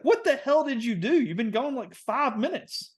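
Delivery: high-pitched exasperated father; incredulous dad. Retold or imitated. Dad on the phone to his arrested son, incredulous. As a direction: imitate a dad in disbelief, voice way up.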